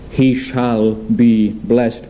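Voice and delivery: very slow, measured and final; solemn pronouncement. Retold or imitated. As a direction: pronounce the quoted blessing word by deliberate word. Isaac refuses to revoke the blessing.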